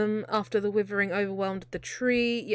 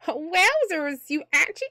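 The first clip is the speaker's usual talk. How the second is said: higher put-on character voice, tried once; reading the line in a higher, put-on cartoon voice; 7 semitones higher than usual. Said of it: "I don't wanna do that voice".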